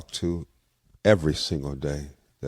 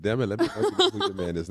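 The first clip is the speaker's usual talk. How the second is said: fast, laughing playful scold; mock-exasperated, laughing; 5 semitones higher, much faster than usual. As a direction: tease her through a laugh. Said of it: "I said".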